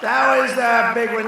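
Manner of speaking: That was the big one, punched up high and loud. Real, not acted. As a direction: hammer it home to the crowd. Louder higher repeat.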